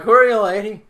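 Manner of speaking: put-on high, very loud drunken holler. Drunk player calling out to the veiled woman, drunken holler. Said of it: "I'm still all drunk".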